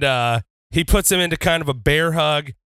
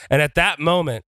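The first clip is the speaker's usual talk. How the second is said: usual speaking voice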